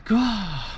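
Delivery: manga-style strained yell; high strained cry. Acted. Reading manga, character's straining cry.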